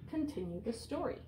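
plain reading voice

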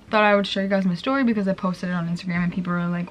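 normal talking voice

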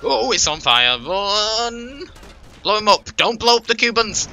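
panicked high shouts; excited yelling. Real, not acted. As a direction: yell out in a sudden panic. Car on fire, yelling to run.

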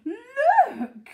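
high, loud startled cry; excited shout. A narrator's reading. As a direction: call out in alarmed amazement. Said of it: "Missus Silver was shouting".

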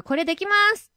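high-pitched, self-assured claim; confident declaration. Acted. Actress presenting her skills in a voice sample, high confident declaration. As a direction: announce it as if fully sure. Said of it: "自信持って言おう".

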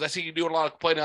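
rattled off fast, mocking the coworker; quick dismissive mimicry; rushed mock coworker. Quoting coworker's jab at him, fast and mocking. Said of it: "He goes".

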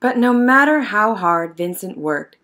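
plain reading voice

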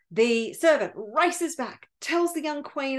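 plain reading voice

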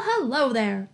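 usual speaking voice

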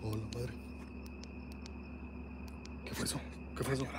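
hushed, startled and uneasy; quiet spooked question; scared horror game streamer. Real, not acted. Strange noise heard on stream.

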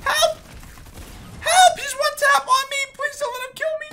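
high frantic screams for help; panicked screaming. Acted. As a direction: shriek for help like you're dying. Panicked cry for help in game.

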